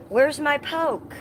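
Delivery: high, slow puzzled call; high slow where's my poke; puzzled search. Real, not acted. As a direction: draw it out wondering where it went.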